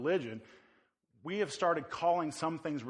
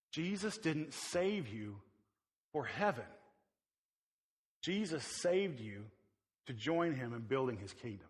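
quiet slow emphasis; 3 semitones lower, 5 dB quieter than usual. Real, not acted. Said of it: "this is important".

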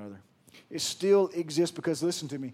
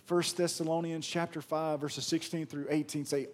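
ordinary talk, nothing marked

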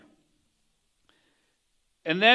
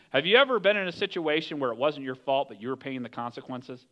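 ordinary talk, nothing marked

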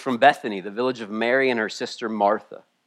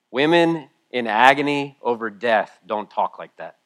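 ordinary delivery

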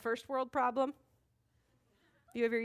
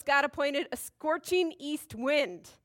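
ordinary talk

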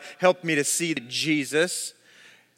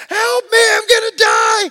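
very high, loud mock-terrified screams; hammed-up panicked shriek; panicked screaming; 10 dB louder than usual. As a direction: reenact a child screaming for help. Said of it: "I started screaming to my buddy".

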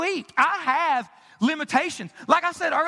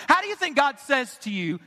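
ordinary delivery

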